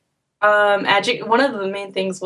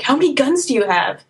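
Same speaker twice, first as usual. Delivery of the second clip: higher-pitched, wide-eyed mock curiosity; imitated eager questioning; 6 semitones higher than usual. Australians quizzing an American about guns, imitated eager curiosity. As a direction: pepper them with rising, incredulous questions.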